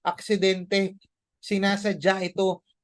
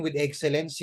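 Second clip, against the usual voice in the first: usual speaking voice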